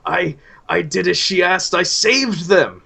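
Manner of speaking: high, loud, frantic raving; manic madman. Acted. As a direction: rave it out like a man gone mad. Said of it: "and he keeps cackling".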